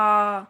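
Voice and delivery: drawn-out आ sound; long vowel आ. Shown as a demonstration. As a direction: stretch the आ out long. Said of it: "अगर हमने इसको अ बोला है".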